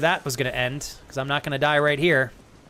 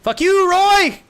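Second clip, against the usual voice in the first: slow, much higher, very loud yell; mock furious yell; 10 dB louder, much slower than usual. Yelling in mock rage at Roy for teasing. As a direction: bellow it at the chatter, slowly.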